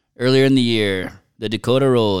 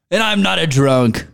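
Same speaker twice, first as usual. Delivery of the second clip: high pretend protest; mock defensiveness; 6 semitones higher, 4 dB louder than usual. Dad denying his drinking, mock defensiveness. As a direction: insist with hammed-up indignation.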